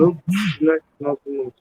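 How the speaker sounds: ordinary talk, nothing marked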